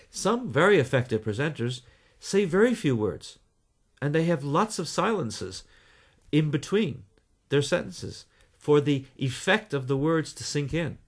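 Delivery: short phrases with a silence after "words", "silences" and "in between"; demonstrating pauses, each short phrase left hanging before the next; deliberate silences. Shown as a demonstration. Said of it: "as I'm actually showing you now".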